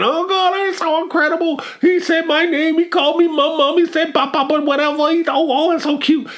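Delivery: very high, hammed-up gushing; exaggerated high delighted parent; mock teary excitement. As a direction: gush like a proud parent near tears. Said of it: "and they crying".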